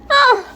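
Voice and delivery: short high, loud cry; sudden high yelp of shock; startled scream. Acted. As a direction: cry out in dismay. Mom discovers ruined cards.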